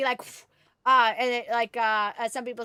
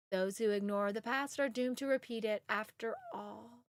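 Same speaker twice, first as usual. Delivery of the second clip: Viridian game voice; 3 semitones lower than usual. Acted. Reads character dialogue, tone adjusted.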